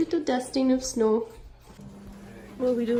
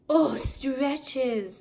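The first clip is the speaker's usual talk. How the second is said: ordinary talk, nothing marked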